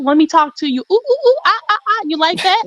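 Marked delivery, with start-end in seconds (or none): 0.9-2.0 high mocking ape hoots; monkey noises. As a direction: hoot like a monkey to mock him.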